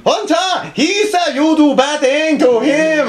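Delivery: high, slow mock broken-English accent; mock Korean accent. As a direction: put on a hammed-up North Korean official voice. Mock North Korean interrogator.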